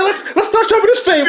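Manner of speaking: a hint of a pulpit boom; hammed-up but light; mock preacher voice. Man showing his rival how he sounds, in a put-on preacher tone. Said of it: "if I sound like".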